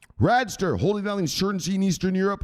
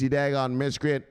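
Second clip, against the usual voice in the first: ordinary talk, nothing marked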